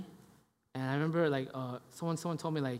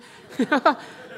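loud, high burst of laughter; real laugh; 9 semitones higher, 8 dB louder than usual. Real, not acted. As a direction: laugh out loud at your own story. Laughing at his own retold story.

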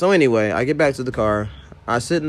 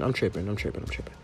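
whispered; 3 semitones lower, 11 dB quieter, faster than usual. Real, not acted. Whispered repeat to self.

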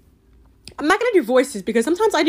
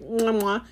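playful "mwah" kisses; mock smooching noises; kissing sounds. Shown as a demonstration. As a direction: blow two affectionate air kisses. Imitates affectionate kisses on forehead.

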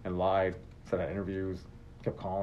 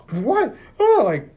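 much higher, louder shocked "what"; stunned disbelief; 11 dB louder, much slower than usual. Retold or imitated. School staff laugh at him.

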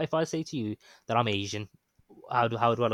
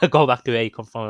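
raised voice, imitating a passing driver's shout; retold shout; 10 dB louder than usual. Man retelling abuse shouted at him from the road, retold shout. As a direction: quote the heckler loudly, at an ordinary pitch. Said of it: "and he shouts".

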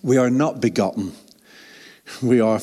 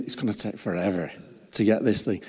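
mock weary grumble; 5 semitones lower, 7 dB quieter than usual. Hungry, waiting for the chicken.

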